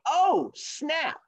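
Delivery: high, drawn-out "oh" of mock shock; cartoonish taken-aback exclamation; mock-impressed reaction. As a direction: react to a challenge with playful, high-pitched amazement. Rabbit reacts to turtle's challenge.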